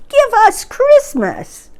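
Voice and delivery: high, loud, slow troll demand. A narrator's reading. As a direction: trolls shouting their demand.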